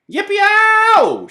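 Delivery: very high loud yippee; loud high cowboy yell; cowboy whoop. Acted. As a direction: holler like a rodeo cowboy. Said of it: "shouted Leroy Ninker".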